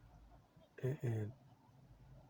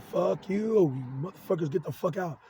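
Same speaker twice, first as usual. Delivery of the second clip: raised-voice retort, hardest at the start; retold angry outburst; 5 semitones higher, 6 dB louder than usual. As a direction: re-enact telling them off, higher at first. Retelling his angry replies to the voices.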